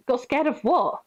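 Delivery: mock incredulous query; incredulous question. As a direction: ask in disbelief.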